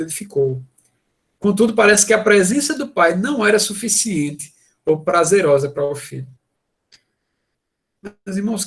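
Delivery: usual speaking voice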